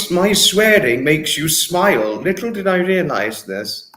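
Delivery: usual speaking voice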